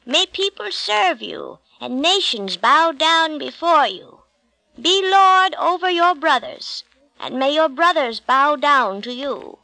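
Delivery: put-on high, slow, ceremonial old father's blessing; solemn blessing. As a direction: intone it like a sacred rite, rising on lord. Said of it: "said Isaac as he gave the blessing".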